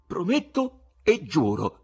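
slow and solemn; a slow, grave promise; solemn vow. A narrator's reading. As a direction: swear it like an oath. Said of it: "dichiarò Ivan solennemente".

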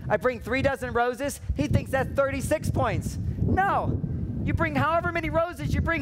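ordinary talk, nothing marked

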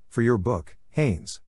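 ordinary delivery, nothing marked

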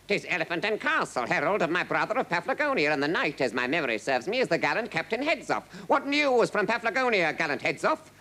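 high, brisk royal greeting; grand king. A narrator's reading. King receiving the knight and herald, grandly. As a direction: hail them regally at a brisk pace.

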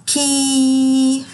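كي with the ee held long; long teacher كي; long drawn vowel. Shown as a demonstration. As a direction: stretch the kee out for the class. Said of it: "صوت طويل ويبقى صوتها".

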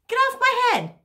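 high-pitched, startled outburst; sharp, high cry of protest; indignant yell. Acted. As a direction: yelp it out as an indignant order. Cat orders bird off its head.

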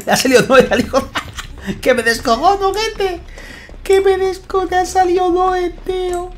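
laughing disbelief. Real, not acted. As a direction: squeal it out while cracking up. Laughing at teammate's lucky roll. (riéndose con voz aguda y lenta)

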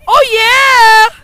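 loud high cheer; triumphant shout. Real, not acted. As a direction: celebrate the catch. Celebrating a good play.

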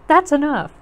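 fed-up little sister; upset protest. A narrator's reading. Sister stops brother's scary wailing.

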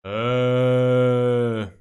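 long, loud, low "ehhh"; mock a whole class going "ehhh"; long classroom jeer. Whole class reacts to a silly remark, long droning jeer. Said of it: "todos en el salón dicen".

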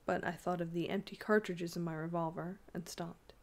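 ordinary delivery

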